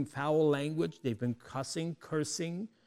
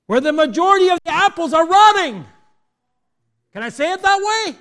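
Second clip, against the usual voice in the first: loud, high preacher's delivery; impassioned preaching; 9 semitones higher, 10 dB louder than usual. Acted. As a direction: drive it home to the congregation at full volume. Preaches at full force.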